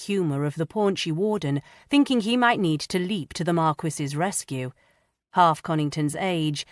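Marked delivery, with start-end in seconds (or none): none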